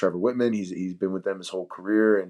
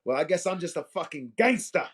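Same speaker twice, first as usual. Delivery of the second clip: higher-pitched mock British accent; 9 semitones higher than usual. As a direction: ham up a British gangster accent, pitched high. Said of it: "we all love the, the accents".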